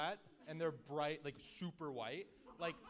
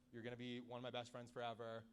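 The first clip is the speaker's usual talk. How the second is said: ordinary talk, nothing marked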